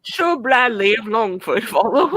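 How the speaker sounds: slow, high, pompous British; drawn-out courtly command; mock knighting.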